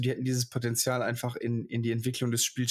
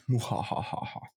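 mustache twirling villain; slower than usual. Pretend. Threatens to take over channel.

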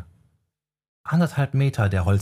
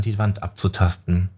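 plain reading voice

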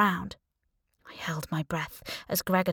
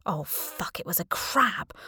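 horrified realization. A narrator's reading. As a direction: sink as the horror dawns. Narrator realizes a crab.

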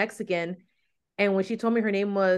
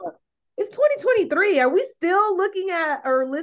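incredulous retort; 9 semitones higher, 5 dB louder than usual. Retold or imitated. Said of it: "And I'm like".

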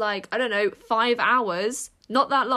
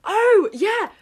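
oh, yeah! jumping high and loud; sudden loud enthusiastic cry; eager exclamation; 11 semitones higher, 10 dB louder than usual. Real, not acted. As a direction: burst out with keen excitement. Eager for DNA test talk.

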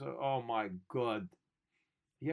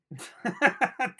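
burst of laughter; 10 semitones higher, 12 dB louder than usual. Real, not acted. Mocking the letter.

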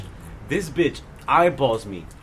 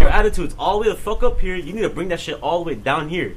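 re-enacted scolding, pitched up on the first half; indignant telling-off; 4 semitones higher, 7 dB louder than usual. Student scolding staffer over attitude, acted indignation. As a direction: start high and loud, then come down.

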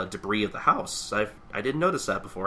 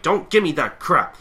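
higher, louder snap back; angry retort; 9 semitones higher, 9 dB louder than usual. Retold or imitated. Tatsumi refusing the chief's orders, higher, louder angry retort. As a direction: throw it back at him, fired up. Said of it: "she's like".

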